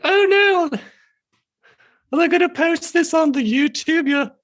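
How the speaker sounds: very high, worried British puppet voice; Worry Fox. Acted. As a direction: fret in a squeaky-high British falsetto. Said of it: "his voice is very high and worried".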